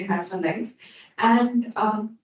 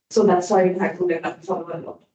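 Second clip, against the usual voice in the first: normal talking voice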